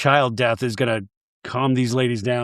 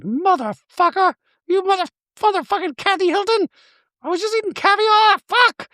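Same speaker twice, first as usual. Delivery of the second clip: high, huffy mock cursing as Dorit; Dorit impression. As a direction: fume in a high prissy voice. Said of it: "Dorit is like".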